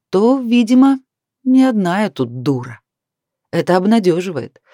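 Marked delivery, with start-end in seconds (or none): none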